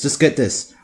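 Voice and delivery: ordinary talk, nothing marked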